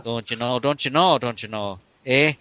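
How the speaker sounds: lightly put-on Upper Midwest accent, don't you know; a hint of Wisconsin Packer fan twang; Minnesota accent. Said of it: "It sounds like a Minnesota accent".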